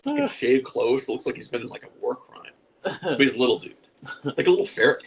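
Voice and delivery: usual speaking voice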